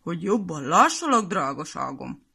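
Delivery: wolf faking a grandma voice, trailing low; sweet fake granny tone from the wolf; the wolf in grandma's bed answers in a disguised voice. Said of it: "elváltoztatott hangon".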